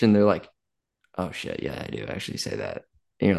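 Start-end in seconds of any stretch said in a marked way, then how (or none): 1.2-2.8 low, soft mock admission; imitated sheepish realization. Client admits to coach they do say it, imitated low sheepish realization. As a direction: drop down low and quiet, as if conceding the point.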